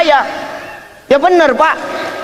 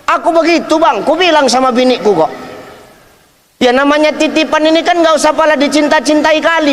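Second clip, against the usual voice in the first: usual speaking voice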